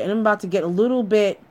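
usual speaking voice

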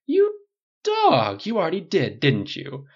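high, loud teasing jab that settles down. A narrator's reading. Stylist teasing Tony he already slept with him, high campy. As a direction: catch him out playfully. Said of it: "said teasingly".

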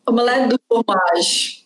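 slow, hammed-up French phrase "Omelette du fromage"; mock French accent. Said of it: "no que eu aprendi no laboratório de Dexter". (sotaque francês de desenho, lento e exagerado)

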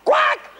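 very high squawking quack; sudden shrieked duck squawk; reflex quack. Acted. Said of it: "I squawk whenever someone mentions".

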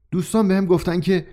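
questioning. Acted. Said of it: "با نگاهی پرسشگر گفت".

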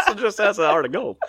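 high squealing laugh through the words. Real, not acted. Laughing repeat of a joke.

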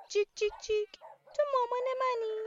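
high, loud chirpy chick voice cheeping its question; little lost chick. Acted. Lost chick asks the cow for its mum, high chirpy cheep. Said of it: "بهش گفت".

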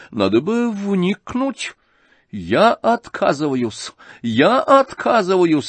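высоко и медленно, отмахиваясь; театрально отказываясь, растягивая слова; генеральша отказывается. Acted. (генеральша отмахивается от дела, высоко и медленно)

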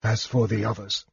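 soft, spent aside; dismissive, hushed. A narrator's reading. Mage shrugging off the missing friends, softly dismissive. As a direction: shrug it off in a weak, quiet voice. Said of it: "he shrugged".

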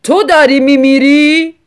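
husband shouting in disbelief at his wife. A narrator's reading. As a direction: yell it back at her. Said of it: "رو به او داد زد".

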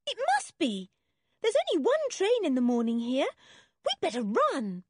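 put-on high, urgent child's voice; urgent excitement. A narrator's reading. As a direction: urge them on, pitched high. Children hurrying to catch the train.

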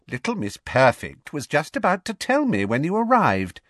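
mock Hermione to her face; snide Rita. A narrator's reading.

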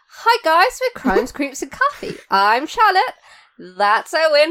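bright, loud host intro; show intro. Acted. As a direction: welcome the listeners, upbeat. Podcast intro.

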